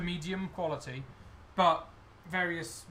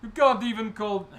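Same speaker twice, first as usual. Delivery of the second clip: put-on louder, higher Russian-accented goblin; 6 semitones higher, 8 dB louder than usual. Goblin confessing about the wine, louder Russian accent. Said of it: "doing a Russian accent for some reason".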